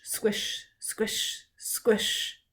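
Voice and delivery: soft unvoiced squish sounds; whispered squish. A narrator's reading. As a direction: whisper each squelch.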